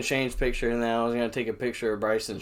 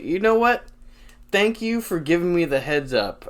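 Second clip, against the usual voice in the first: retold as pointed, over-earnest thanks; emphatic thanks; 5 semitones higher than usual. Drunk fan answering the manager's warning, high emphatic thanks.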